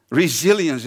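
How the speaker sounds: "Resilience." said the English way, /rizɪliəns/; English pronunciation. Shown as a demonstration. Preacher shows the English word to the congregation, pronounced in English. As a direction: give "Resilience." its English sound, ri-ZIL-yence. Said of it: "Het Engelse woord is resilience".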